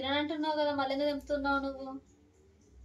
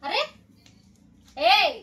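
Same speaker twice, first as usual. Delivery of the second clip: mother yelling at her son who refuses his curry; angry scolding; 5 semitones higher, 11 dB louder than usual. Real, not acted. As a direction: lose patience and shout him down.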